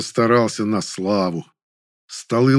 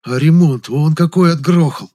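lightly acted envious gushing, pitched higher; envious, caustic; 4 semitones higher, 4 dB louder than usual. A narrator's reading. Assistant to the director about a rival's brilliant catch, envious and caustic. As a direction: marvel with a touch of venom. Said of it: "завистливо добавил ассистент язвительным голосом".

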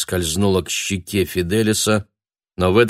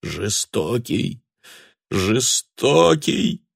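wailing lament; 4 semitones higher, much slower than usual. A narrator's reading. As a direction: lament after him, slow and drawn out. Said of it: "причитал он вслед".

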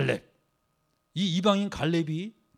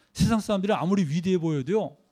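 usual speaking voice

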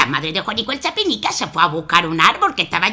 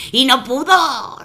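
ordinary delivery

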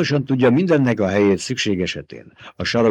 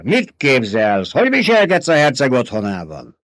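indignant outburst, higher and pushed; angry rebuke; 4 semitones higher, 4 dB louder than usual. A narrator's reading. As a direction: snap at her in outrage. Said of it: "Förmet rá Jessica-ra". (felháborodottan ráförmedve)

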